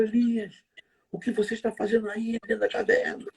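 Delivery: high, scolding demand; accusing God voice. Retold or imitated. Preachers' version of God rebuking Elias, scolding. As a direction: call him out for hiding. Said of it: "Eu já vi alguns pregadores falarem assim".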